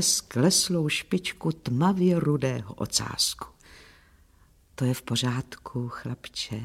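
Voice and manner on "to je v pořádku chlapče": low, very soft murmur; whispered reassurance. A narrator's reading. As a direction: soothe the dog in a hushed, low voice. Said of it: "zašeptala jsem". (tiché, konejšivé šeptání)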